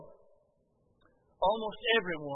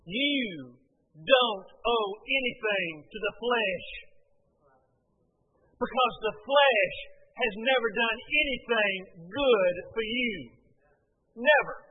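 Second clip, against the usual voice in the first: slow, louder preacher's emphasis; preacher hammering the point, louder; fervent preaching; 4 dB louder, slower than usual. Acted. Preacher tells his congregation they owe the flesh nothing, in a fervent loud preaching voice.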